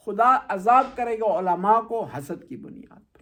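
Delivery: normal talking voice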